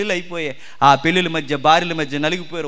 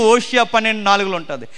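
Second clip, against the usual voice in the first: usual speaking voice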